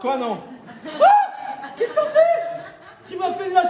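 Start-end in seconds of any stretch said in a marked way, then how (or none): 0.8-2.4 high, hammed-up gasp of surprise; mock shock. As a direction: play fake horror at the trick. Magician fakes shock at trick.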